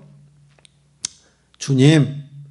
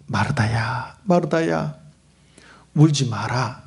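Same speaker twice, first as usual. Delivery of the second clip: tender comfort; 7 semitones lower, slower than usual. Acted. Jesus comforting weeping Martha.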